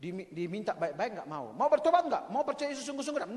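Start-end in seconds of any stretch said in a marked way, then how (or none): 1.5-3.3 pressing challenge. Acted. Acted pastor challenging sinner.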